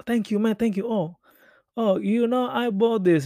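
reply as if pleasantly flattered; a hint of chuffed English small talk; flattered stranger. Acts out flattered reply to compliment.

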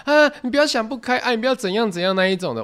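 high, hammed-up pleading; mock-beg her not to jump; pleading classmates. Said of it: "大部分都到齐说". (高声夸张地模仿同学劝说)

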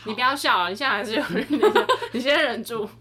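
laughter breaking through the words; fighting back giggles while talking; trying not to laugh. Real, not acted. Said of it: "[name]忍笑失败。". (忍着笑说话)

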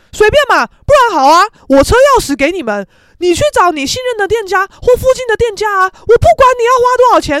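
loud, high, escalating tirade; mock fed-up shouting; imitated fury. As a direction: act out mother losing patience, climbing to a yell. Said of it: "我妈就很火大".

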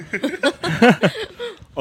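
long high-pitched laugh. Real, not acted. Host laughing before her story, high laugh.

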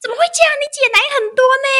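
high, loud, fast mock disbelief; mom's shocked outburst. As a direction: rattle it off in mom's shrill disbelief. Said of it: "说".

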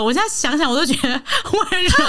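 high laughter with a crying edge; laughing near tears. Real, not acted. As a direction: laugh through tears at the absurdity. Reliving the absurd breakup. (笑中带泪)

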